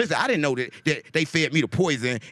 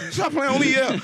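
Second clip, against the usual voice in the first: laughing outburst; 9 semitones higher than usual. Real, not acted. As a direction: burst out laughing as you protest.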